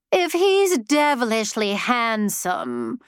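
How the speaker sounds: high-pitched, nasal, mocking; high nasal ghost voice. A narrator's reading. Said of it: "said a high-pitched nasal voice".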